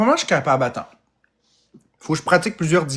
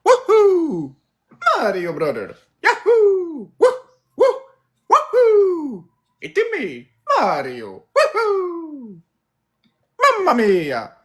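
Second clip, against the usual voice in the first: high-pitched video game hero whoops; Mario; 14 semitones higher, slower than usual. Shown as a demonstration. Imitating the game character for the audience, high cheery whoops. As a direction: whoop high, with falling tails. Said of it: "Mario Bros, c'est facile".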